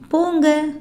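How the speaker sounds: normal talking voice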